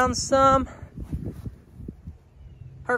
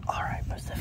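soft unvoiced whisper to the cow; 10 dB quieter than usual. Real, not acted. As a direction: breathe it without voice. Lying against the cow.

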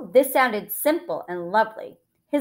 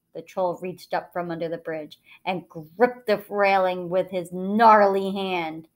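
plain reading voice

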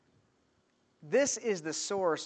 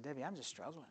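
quiet; 14 dB quieter, faster than usual. Retold or imitated. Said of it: "I said".

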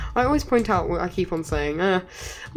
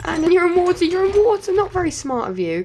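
gleeful taunt; 11 semitones higher, 4 dB louder than usual. Real, not acted. Taunting enemy in water.

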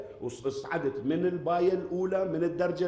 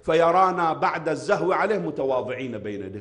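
full-force preaching; 6 dB louder than usual. Acted. Preacher's opening, slow and loud. (خطابة منبرية بصوت عالٍ وبطيء)

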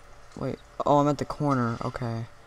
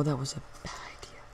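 fast whispered aside; 8 dB quieter, much faster than usual. Real, not acted. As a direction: breathe it out fast under breath.

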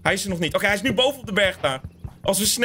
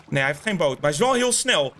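ordinary delivery

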